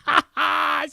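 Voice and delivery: high shrieking burst of laughter; wild high laugh. Real, not acted. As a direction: cackle out loud, high.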